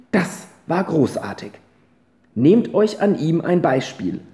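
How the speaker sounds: grandmother's speech. Acted. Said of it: "sagte die Großmutter".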